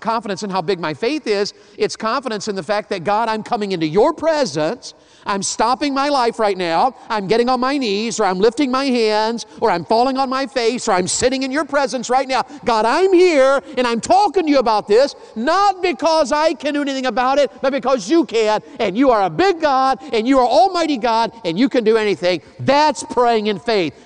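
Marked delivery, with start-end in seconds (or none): 3.0-22.4 loud, high, impassioned prayer; fervent prayer. Acted. Voices fervent prayer to God. 22.6-23.9 emphatic preaching. Real, not acted. Preaching punchline.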